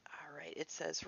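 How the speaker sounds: unvoiced aside before reading; hushed lead-in; whispered aside. Real, not acted. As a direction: whisper it to yourself.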